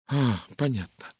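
usual speaking voice

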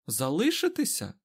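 high, rising cry of disbelief; indignant exclamation. A narrator's reading. Egwene is told she may stay, exclaims indignantly. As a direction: exclaim it as an incredulous question. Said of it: "вигукнула Егвейн".

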